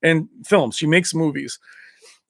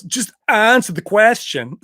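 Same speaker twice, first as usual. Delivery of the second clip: put-on slow, higher, firm accented demand; Van Damme impression; 6 semitones higher, 4 dB louder, slower than usual. Van Damme insisting the reporter answer, mock accent. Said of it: "The way he said it is awesome".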